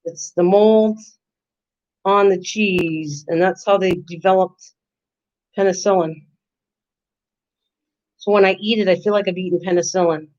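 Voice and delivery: ordinary delivery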